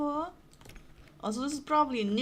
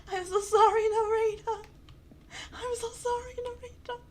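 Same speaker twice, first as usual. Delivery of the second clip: tearful apology; 6 semitones higher than usual. Real, not acted. Player apologizing to the narrator, tearful. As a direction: wail the sorry, then whimper it. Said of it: "I'm about to cry".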